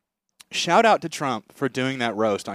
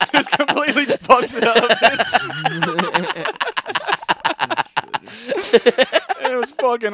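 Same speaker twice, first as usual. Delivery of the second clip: laughing; 11 semitones higher, 7 dB louder, slower than usual. Real, not acted. Laughing through the bit.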